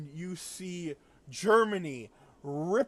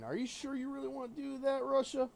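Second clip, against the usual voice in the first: cowboy America; 9 semitones higher than usual. Retold or imitated. America as a cowboy confronts Russia, higher mock cowboy voice. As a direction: play a cocky cowboy questioning Russia. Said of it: "America showed up dressed up as a cowboy".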